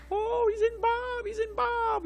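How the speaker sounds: very high, breathy-free falsetto mock of the rapt crowd; swooning devotee. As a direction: mimic the audience gushing in a squeaky high voice. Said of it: "And the whole audience".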